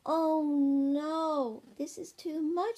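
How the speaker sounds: loud, high, drawn-out dismay; exasperated. A narrator's reading. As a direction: groan out your exasperation.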